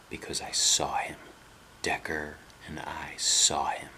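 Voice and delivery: eerie whisper, slowing down; spooky whisper. Acted. Boy whispering into Sean's face to scare him. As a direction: whisper it close to his face.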